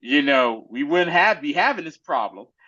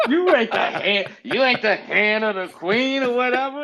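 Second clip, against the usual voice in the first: mocking retort; 8 semitones higher than usual. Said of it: "He said, he said".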